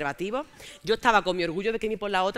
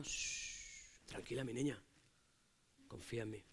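soft hushing; 9 semitones lower, 19 dB quieter, slower than usual. Retold or imitated. Antonio hushing her close.

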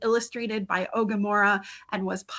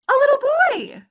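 high, loud surprised reveal; spring the surprise of who's there; 9 dB louder than usual. Acted. Storyteller revealing the boy at the door, surprised.

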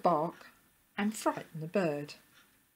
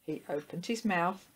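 ordinary delivery

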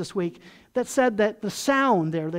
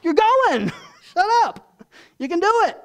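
high, loud mock exasperation; mock angry God; 9 semitones higher, 6 dB louder than usual. God fed up with Moses' excuses, loud mock anger. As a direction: snap at him, half joking. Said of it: "God gets angry with him and says".

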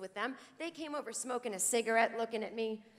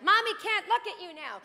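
higher and louder, a hammed-up frazzled mother; overwhelmed mommy; 9 semitones higher, 6 dB louder than usual. Jen pretending to be a frazzled mother to her mail, hammed-up. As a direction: playact a mom who can't cope. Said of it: "I'm like".

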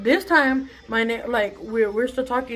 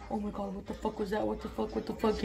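hushed, frightened whispering; scared whisper; 2 semitones lower, 12 dB quieter than usual. Retold or imitated. Hiding in the shower, whispering in fright. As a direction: whisper in panic while crouched.

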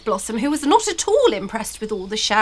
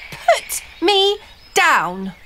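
slow, higher demand to be put down; 6 semitones higher, much slower than usual. A narrator's reading. Blossom to the trolls who grabbed her, demanding. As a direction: order them firmly, word by word. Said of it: "she demanded".

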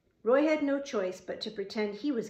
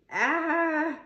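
high cry of "ah"; 7 semitones higher, 4 dB louder than usual. A narrator's reading. Pinned boy cries out.

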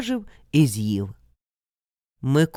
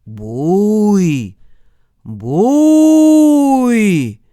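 long echoing shout across the forest; hollering through the dark woods; distant call; 4 semitones higher, 9 dB louder than usual. A narrator's reading. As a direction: call out loud from far away, rising on the second. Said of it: "хтось вже ближче крикнув".

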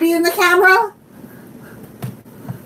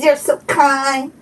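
ordinary delivery

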